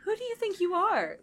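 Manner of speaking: high-pitched, affronted challenge; glaring indignation. Acted. Lumine confronting presumptuous Inessa, indignant. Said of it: "Lumine glares at her".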